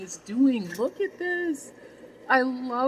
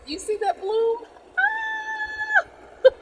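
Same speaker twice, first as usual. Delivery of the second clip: very high, louder, bursting with glee; a high, delighted cry over a flower; thrilled squeal; 4 dB louder, much slower than usual. Real, not acted. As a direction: shriek with joy at the bloom. Spots the bloom, squeals.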